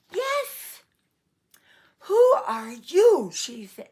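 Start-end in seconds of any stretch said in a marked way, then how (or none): 0.1-0.8 magical bear. A narrator's reading. Bear answers Rella. 2.1-3.3 loud, slow, higher startled question. A narrator's reading. Rella startled by bear.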